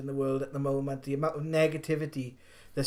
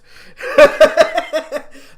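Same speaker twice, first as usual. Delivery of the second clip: high, loud cackling laugh; loud burst of laughter; 13 semitones higher, 16 dB louder than usual. Real, not acted. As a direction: crack up laughing loudly. Laughs at the quoted disclaimer.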